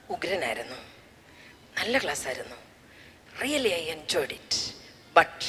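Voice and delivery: low hushed schoolboy voice, ending in a whisper; a shy boy murmuring his doubt, trailing into a whisper; quiet boy confiding. Retold or imitated. Boy telling Grace after class he disagrees, hushed and low.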